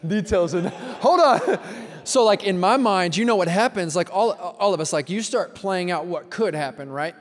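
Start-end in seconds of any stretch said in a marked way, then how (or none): 0.1-1.6 laughing retelling. Retold or imitated. Pastor retells his reply to the frantic call, laughing. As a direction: laugh at your own panic as you say it. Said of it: "And I was like".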